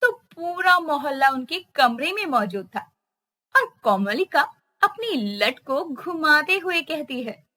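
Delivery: usual speaking voice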